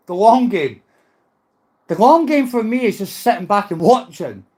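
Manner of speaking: usual speaking voice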